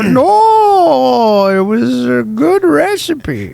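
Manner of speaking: high, slow, hammed-up dad; mock dad voice. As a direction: play an exaggerated dad, drawn out and high. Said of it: "Mister Dad".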